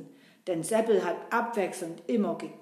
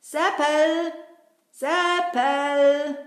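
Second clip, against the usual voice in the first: louder, sing-song calling out; 5 semitones higher, 6 dB louder, much slower than usual. Real, not acted. Kasperl shouting for Seppel to come meet him. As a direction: call his name across the square. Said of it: "ich werde mal laut nach ihm rufen".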